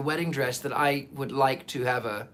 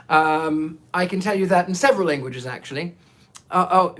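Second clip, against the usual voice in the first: ordinary delivery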